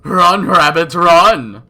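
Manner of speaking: loud high taunting yell; menacing shout. Acted. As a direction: taunt her as she runs. Villain chases the girl.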